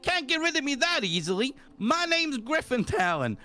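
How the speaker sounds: high New York tough-guy cat voice; New York accent. Acted. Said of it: "in my fucking New York accent".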